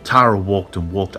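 ordinary delivery, nothing marked

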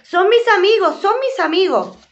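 put-on high, loud girlish cry; shouting. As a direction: yell it to stop her father. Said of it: "Ricito le gritó".

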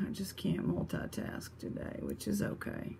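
whispered aside to herself. Real, not acted. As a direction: breathe it out, barely voiced.

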